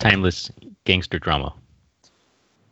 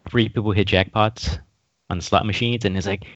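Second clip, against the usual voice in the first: normal talking voice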